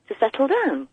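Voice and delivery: ordinary delivery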